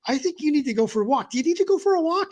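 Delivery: imitating girlfriend. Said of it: "my girlfriend says".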